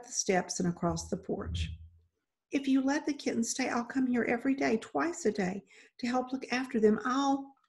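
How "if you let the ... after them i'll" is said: quick, higher, hushed promise; hurried pleading. Acted. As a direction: bargain in an urgent rush. Girl hurriedly bargains before husband enters.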